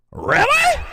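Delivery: high, loud mock shriek of disbelief; mocking Pete Buttigieg. Vlogger mocks Pete Buttigieg's reaction, high shocked shout. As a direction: squeal it like a fake-shocked politician. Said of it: "that's the way Pete Buttigieg is talking".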